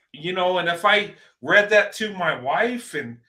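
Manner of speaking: ordinary talk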